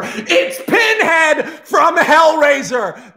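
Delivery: high, loud, drawn-out announcement; huge theatrical reveal, stretched out; excited reveal shout. Real, not acted. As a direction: shout the surprise villain's entrance slowly. Big reveal shouted by the storyteller.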